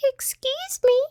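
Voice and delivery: very high, squeaky tiny mouse; high-pitched little voice. A narrator's reading. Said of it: "said a high-pitched little voice".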